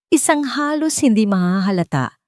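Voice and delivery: ordinary delivery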